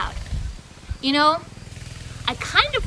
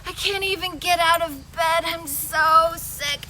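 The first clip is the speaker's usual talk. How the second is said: slow, drawn-out moan; moaning sick woman; 2 semitones higher, 5 dB louder, slower than usual. Acted. Maria moaning weakly from her bed. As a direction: groan it out from a sickbed. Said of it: "She's there laying in her bed".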